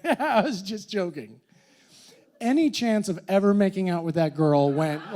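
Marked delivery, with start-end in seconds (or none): 0.0-1.4 giggling through the words; laughing confession. Retold or imitated. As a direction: laugh it out, caught red-handed. Confessing prank while laughing.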